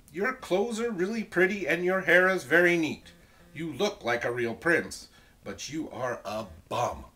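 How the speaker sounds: loud, emphatic storybook retort; Elizabeth's telling-off. Acted. Elizabeth puts down ungrateful Ronald, loud and firm. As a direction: start high and loud, drop to a firm verdict.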